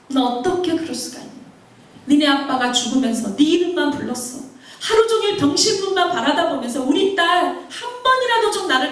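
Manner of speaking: acted anguished telling-off; grandmother's scolding. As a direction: reproach climbing to a high-pitched outcry. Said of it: "할머니가 야단을 쳤을 때".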